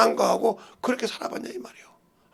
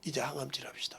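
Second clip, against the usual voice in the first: much softer whisper of the doctor's words; whispered quote; 4 semitones lower, 13 dB quieter than usual. Retold or imitated. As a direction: hush it like a secret. (속삭이듯 조용히)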